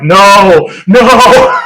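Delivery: hammed-up wail of dread; a playful, dramatic no in dread; mock horrified no. Pictures a life like his dad.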